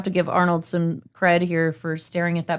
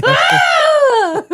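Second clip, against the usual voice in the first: long, very high, loud scream; mock scream; 7 dB louder than usual. Acting the hero's fall into lava, mock scream. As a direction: shriek it out as a held wail.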